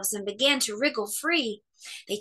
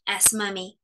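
ordinary delivery

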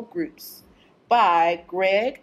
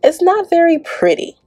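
louder, lively rhyming read-aloud; reading to children; 9 dB louder than usual. Acted. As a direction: bounce through the rhymes for the kids. Reads rhyming picture book aloud.

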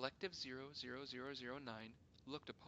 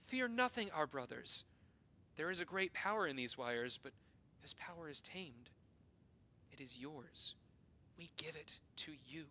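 reassuring call; 4 semitones higher, 6 dB louder than usual. Acted. Reassuring frightened council.

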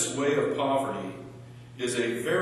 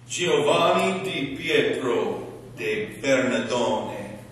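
slow, hammed-up Italian accent; slower than usual. Shown as a demonstration. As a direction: roll the name out in an Italian accent. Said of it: "Just trying out my Italian accent there".

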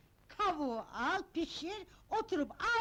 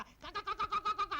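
rapid staccato hen clucks; hen clucking; 4 semitones higher, 3 dB quieter, much faster than usual. Acted. Mistress mimes a chicken for the maid, clucking.

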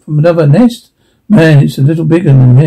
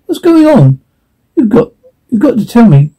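much higher, pleading questions; frightened wife pressing for an answer; alarmed; 7 semitones higher than usual. Acted. Mother begging her husband to explain the noise, alarmed. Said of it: "Ma, alarmed".

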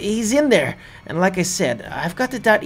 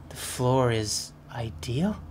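whispering; 7 semitones lower, 8 dB quieter, slower than usual. Real, not acted. Reading a clue in whisper.

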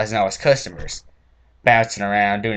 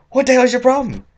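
high, loud outraged shout; furious high-pitched shout; angry yell; 13 semitones higher, 7 dB louder than usual. Retold or imitated. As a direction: bellow at them in disbelief. Yelling at teens in supermarket.

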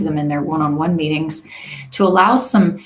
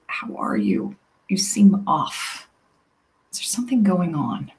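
softer, gentler tone, unhurried; gentle; 3 dB quieter than usual. Shown as a demonstration. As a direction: ask softly and gently, giving it room. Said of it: "saying it in a softer tone, a bit more gentle tone".

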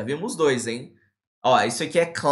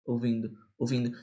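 passive listening; 5 semitones lower, 6 dB quieter than usual. Acted. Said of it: "não adianta você só ficar lá ouvindo".

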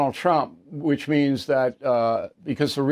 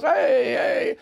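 high, drawn-out party greeting; schmoozing buddy; 9 semitones higher than usual. Retold or imitated. Host imitates press schmoozing with Kennedy over cocktails, chummy. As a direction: hail a drinking pal, pitched well up.

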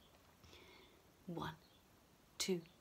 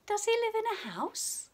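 bright question; 8 semitones higher, 3 dB louder, faster than usual. Acted. Quizzing children in bright question voice.

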